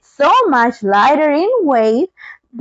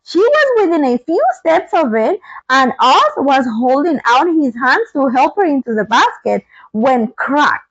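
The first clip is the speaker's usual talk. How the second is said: ordinary delivery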